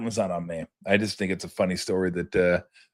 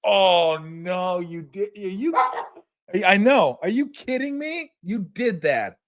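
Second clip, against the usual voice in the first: high, incredulous no-you-didn't; pitched up in shocked disbelief; retelling his outraged reaction to a friend; appalled disbelief; 8 semitones higher, slower than usual. Retold or imitated. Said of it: "I was so appalled at him".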